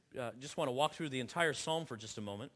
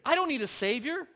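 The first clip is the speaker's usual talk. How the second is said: higher, louder, indignant "I don't need"; mock defiant retort; 9 semitones higher, 5 dB louder than usual. Preacher mimicking a proud sinner, defiant.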